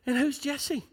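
high, soft mocking question; light, high-pitched scoff. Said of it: "He mocked at him".

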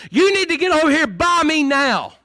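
high, forceful parental command; stern parent. Acted. Parent to child playing in the road, stern command. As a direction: order the child over firmly. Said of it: "No, you tell them".

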